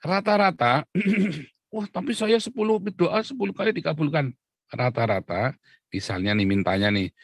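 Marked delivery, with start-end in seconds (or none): 1.7-4.3 high mock voice of a boastful believer; high mock voice of a believer bragging about answered prayers; objecting believer. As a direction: squeak it out as a smug churchgoer.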